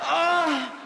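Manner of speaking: high-pitched mock moan; imitated can sigh. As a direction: open the can with a campy sigh. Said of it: "Sounds like this".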